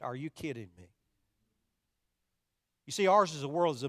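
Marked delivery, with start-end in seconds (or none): 0.0-0.9 low, hushed disbelief. Acted. Preacher imagining himself as poor Joseph hearing the news, in hushed disbelief. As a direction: say it much lower and softer, quietly incredulous. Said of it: "I probably would have said".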